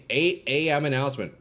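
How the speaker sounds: ordinary delivery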